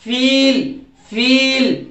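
"फील" with a stretched ee; long E. Shown as a demonstration. Teacher models for learners, long "फील". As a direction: hold the vowel in "फील". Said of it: "ऐसे बोलना आपको".

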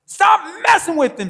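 high, very loud exasperated yell; frustrated dad shouting. Acted. Preacher acting the boy's dad yelling at Satan, exasperated shout. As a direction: shout it like a dad at his wits' end. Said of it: "this boy's dad was like".

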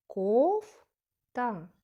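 "Кофта" with the first syllable loud, long and rising, then a pause; stress on first syllable. Shown as a demonstration. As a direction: say "Кофта" as KOOF... ta, dropping on ta. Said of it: "перший склад наголошений".

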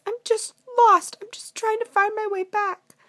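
high, loud princess plea; pleading princess. Acted. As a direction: explain yourself desperately in a princess voice. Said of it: "said the princess".

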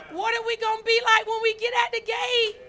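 preacher's cry, half shouted. Real, not acted. Preacher crying out to the congregation about the gate, half shouted. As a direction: call it out with a break in the voice.

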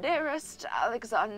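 softly spoken, courtly Ducky impression; hushed, formal British address; British old gentleman. Shown as a demonstration. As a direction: play a gallant British old man. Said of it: "her impression of Ducky".

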